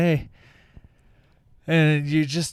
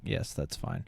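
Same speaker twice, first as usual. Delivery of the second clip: unvoiced hushed reply; whispered aside; 9 semitones lower, 11 dB quieter than usual. Real, not acted. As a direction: whisper it off to the side. Aside on phone call.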